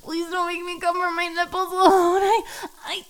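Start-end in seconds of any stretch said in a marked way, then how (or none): none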